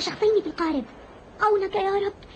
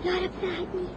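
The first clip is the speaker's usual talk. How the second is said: barely voiced prayer, straining to be heard; weak hoarse plea to God; voice failing; 7 dB quieter than usual. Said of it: "أكاد لا أسمع نفسي".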